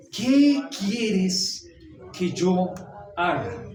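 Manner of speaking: voz suplicante y temerosa, con el qué marcado y el yo alargado; ¿qué quieres que yo haga? dicho con temor y énfasis en qué; trembling plea. Acted. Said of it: "él temblando y temeroso dijo". (súplica temblorosa de Saulo al Señor)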